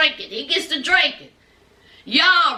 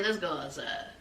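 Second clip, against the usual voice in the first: low, quiet, slurred mumble; drunk boyfriend's mumble; 14 semitones lower, 15 dB quieter than usual. Retold or imitated. Drunk Jovi quietly leading Yara outside, low mumble. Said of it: "So Jovi said".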